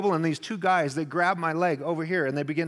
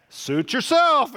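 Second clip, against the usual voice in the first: slow and higher, curt; curt suit yourself; 9 semitones higher, 6 dB louder, much slower than usual. Retold or imitated. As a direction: shrug it off slowly and coldly. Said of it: "And she said".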